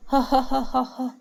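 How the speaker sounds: acted, bitter fit of laughter; hollow ha-ha-ha of someone losing control; hysterical laughter. A narrator's reading. A betrayed son laughing at his father, hysterical. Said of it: "وقعد يضحك ضحك هستيري".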